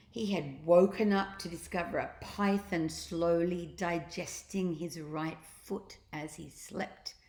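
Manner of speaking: ordinary delivery, nothing marked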